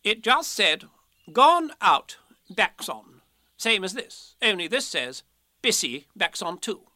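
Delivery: high, emphatic Rabbit voice; impatient Rabbit, explaining. Acted. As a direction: recite the notice as an insistent Rabbit.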